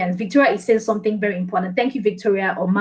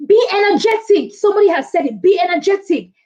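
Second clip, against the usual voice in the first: high-pitched, energetic urging; rally the audience with high energy; 8 semitones higher, 3 dB louder than usual. Real, not acted.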